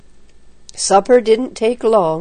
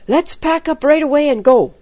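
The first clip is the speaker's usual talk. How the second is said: high-pitched, eager urgency; excited urging; 7 semitones higher, 3 dB louder than usual. A narrator's reading. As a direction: press them excitedly to leave. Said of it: "cried Jack excitedly".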